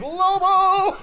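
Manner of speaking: high, loud, stretched out; slow and loud with glee; excited drawn-out exclamation. Real, not acted. Excited agreeing about Lobo.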